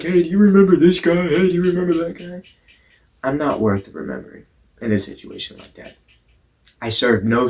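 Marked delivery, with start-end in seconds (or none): 0.0-2.4 louder, higher, eager hey; gossiping voices. Retold or imitated. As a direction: call out like spreading gossip. People gossiping, calling out.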